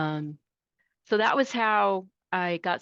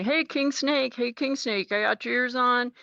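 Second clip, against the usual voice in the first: CB call-out; 7 semitones higher than usual. Retold or imitated. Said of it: "they'd say".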